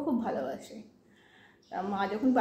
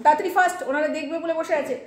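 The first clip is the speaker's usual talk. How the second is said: loud hurry; 4 semitones higher, 5 dB louder than usual. Real, not acted.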